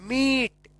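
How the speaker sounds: मीट with a drawn-out ee vowel; long ee. Shown as a demonstration. Teacher showing the double-e rule, मीट with a long, held ee. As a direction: stretch the ee in मीट. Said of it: "थोड़ा लंबा उच्चारण होता है".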